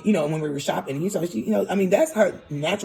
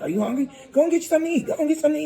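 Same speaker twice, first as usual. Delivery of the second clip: higher, louder mock mom nagging the kids to eat; imitating pushy mom; 12 semitones higher, 3 dB louder, much faster than usual. Retelling mom pushing food on the kids, higher mock nag. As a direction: play mom insisting they eat. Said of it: "she asked them, like, eight times".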